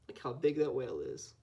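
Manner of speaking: plain reading voice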